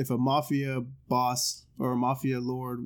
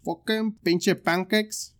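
slow, higher mock Spanish accent; 5 semitones higher, much slower than usual. Shown as a demonstration. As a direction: put on a drawn-out Mexican Spanish accent. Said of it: "Here's the Spanish accent".

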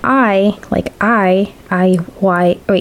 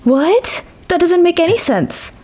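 surprised; 8 semitones higher, slower than usual. Real, not acted. Baffled by the riddle answer.

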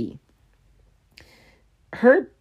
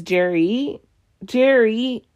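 high, hammed-up blubbering plea with wailing sobs; mock Justin begging Jerry through fake tears; mock crying apology; 9 semitones higher than usual.

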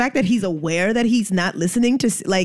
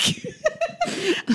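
a high squeal of laughter; high laughing squeal; 6 dB quieter than usual. Real, not acted. Laughing at hardest dick.

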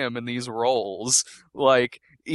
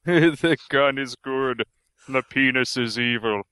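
Zardoz movie quote. Retold or imitated. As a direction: intone it like a stone god. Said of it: "I was just about to say".

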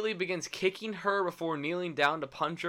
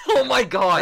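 higher, much louder outburst through laughter; laughing disbelief; 9 semitones higher, 11 dB louder than usual. Real, not acted. Cohost reacts to the absurd story, laughing in disbelief. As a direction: laugh out the exclamation, pitched up.

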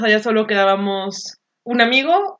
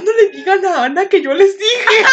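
higher, laughing half-shout; laughing plea; 8 semitones higher, 3 dB louder than usual. Retold or imitated. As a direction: beg them through laughter. Suplicando entre risas. (súplica entre risas)

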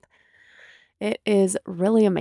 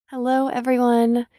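ordinary delivery

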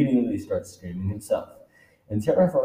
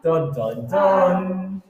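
higher, louder dramatic narration; dramatic reveal; 7 semitones higher, 6 dB louder than usual. Acted. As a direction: build the twist.

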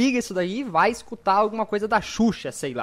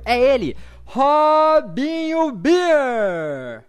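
slow drawn-out hype introduction; announcer voice; 4 semitones higher, 5 dB louder, much slower than usual. Acted. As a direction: stretch the name out like a ring announcer. Host announces the guest.

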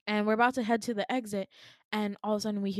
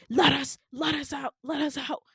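raised, higher mock guard shout; imitated shouting; 5 semitones higher, 4 dB louder than usual. Guards banging at the door, mock shouting. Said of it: "Why are they shouting".

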